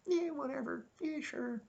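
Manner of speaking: high, limp and quiet mock voice; lifeless, mocking impression of the redubbed voice; bored impression. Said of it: "made him go".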